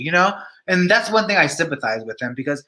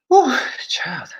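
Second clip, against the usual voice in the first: breathy whew; 9 semitones higher than usual. Real, not acted. Reacts to viewer's glasses.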